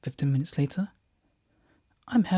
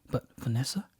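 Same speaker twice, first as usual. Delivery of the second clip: whispered; 2 semitones lower, 9 dB quieter than usual. Acted. Whispered awe.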